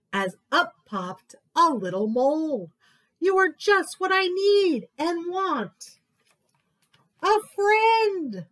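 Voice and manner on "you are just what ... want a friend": high, loud and joyful; delighted. Acted. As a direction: greet the new friend with glee. Mole greets new friend.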